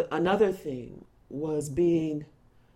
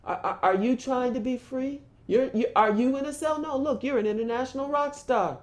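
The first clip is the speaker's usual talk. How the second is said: higher-pitched mock questioning; imagined questioner; 5 semitones higher than usual. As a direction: lightly act out a dismissive doubter, pitched up. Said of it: "no one bothered to ask".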